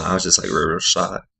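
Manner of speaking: ordinary delivery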